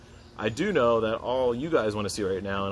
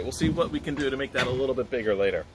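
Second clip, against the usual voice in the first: normal talking voice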